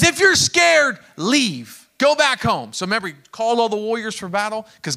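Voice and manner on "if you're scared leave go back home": loud, high order to leave; calling out command. Acted. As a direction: send the fearful home with a shout. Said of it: "He says".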